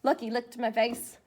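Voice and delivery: plain reading voice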